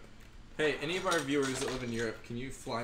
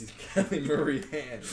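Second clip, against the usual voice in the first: laughing; 3 semitones higher, 6 dB louder, slower than usual. Real, not acted. Echoing the joke, laughing.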